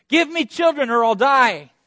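high, loud anguished demand; loud cry. Acted. Rachel crying out her demand, loud and high.

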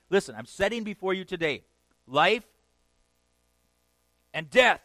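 high, loud climactic preaching; preaching at full force. Real, not acted. Preacher pressing the congregation to choose, impassioned. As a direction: build to the call to choose.

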